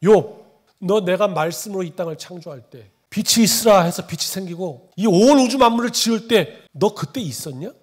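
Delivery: loud, forceful divine challenge; God confronting Job. Acted. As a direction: thunder the question at Job as God. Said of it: "사 절에 이렇게 말씀하시는 거예요". (욥을 꾸짖는 하나님의 우렁찬 목소리)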